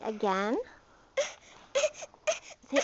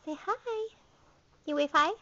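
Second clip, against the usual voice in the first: high sing-song coaxing; 5 semitones higher, 6 dB louder than usual. Real, not acted. Coaxing toddler to wave.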